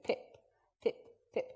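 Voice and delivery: "pip, pip" breathed out softly on the lips; tiny unvoiced "pip" from the lips; whispered pips. Shown as a demonstration. Speaker demonstrating exercise, soft whispered "pip". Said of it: "in between your front teeth and your lips".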